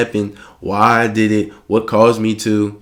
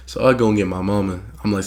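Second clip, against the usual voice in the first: ordinary talk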